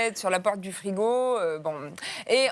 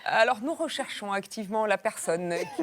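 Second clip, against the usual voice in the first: ordinary delivery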